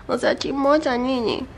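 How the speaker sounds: cooing little telling-off. Real, not acted. Chiding the dog in baby talk.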